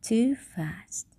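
whispered. A narrator's reading. Listing frightening things.

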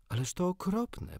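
put-on high, dismayed girl's cry; shocked Wendy. Wendy hearing that the lost boys know no stories, high and dismayed. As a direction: gasp it out as a shocked young girl. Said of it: "powiedziała Wendy".

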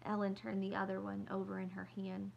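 ordinary delivery

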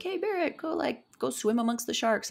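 high-pitched imitation of egging friends; mock coaxing call. Friends egging her on, retold.

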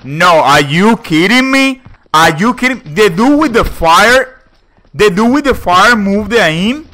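loud, high disbelieving yell; outraged shout. Real, not acted. Teammate outraged at enemy aim.

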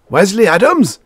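very high, loud, incredulous howl. A narrator's reading. General hearing the stranger's name, howling. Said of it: "howled the general".

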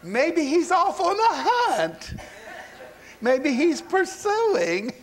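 high, slow singsong mockery; acted laughing heckle; taunting. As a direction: tease them with drawn-out high words. Elijah mocks the prophets' absent god.

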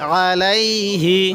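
আলাইহি with the ain pressed firmly, slowly. Shown as a demonstration. Teacher shows a slightly stressed ain, recited slowly. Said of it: "আইনটা একটু চাপ দিয়ে পড়ব".

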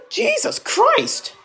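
very high, drawn-out exclamation of disbelief; real exasperated outcry. Real, not acted. Vlogger reacting to someone silenced while grieving, outraged. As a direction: cry it out slowly, voice leaping way up.

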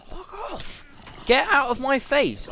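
loud high yell at a mob; real angry outburst; angry shout. Real, not acted. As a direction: shout it off at the attacker. Attacked by mob.

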